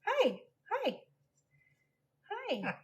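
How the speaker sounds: high sing-song pet voice. Real, not acted. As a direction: coax the bird sweetly. Offering parrot a banana.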